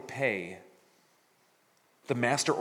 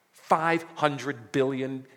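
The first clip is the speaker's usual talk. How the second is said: "five hundred billion" said very slowly and louder; preacher stressing how huge the debt was; slow emphasis; 3 semitones higher, 5 dB louder, much slower than usual. Shown as a demonstration. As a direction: lean on the size of the sum. Repeating the absurd sum.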